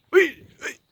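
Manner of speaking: very high falsetto exclamation; high-pitched squeal. Real, not acted. High squeal at an animal companion.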